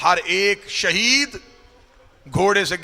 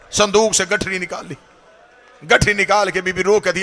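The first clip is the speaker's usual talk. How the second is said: normal talking voice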